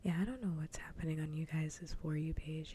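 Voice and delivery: normal talking voice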